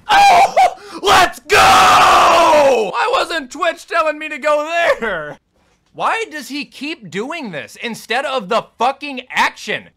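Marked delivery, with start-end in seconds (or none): 0.1-5.4 high, loud victory yell; triumphant shout. Real, not acted. Player screams in joy after beating the boss.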